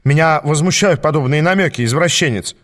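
ordinary delivery

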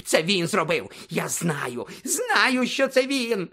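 accusation climbing to a very high screech; hysterical shrieking. A narrator's reading. Snape insisting he knows the culprit, shrieking hysterically. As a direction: shriek it, rising with each insistence. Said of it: "продовжував верещати Снейп".

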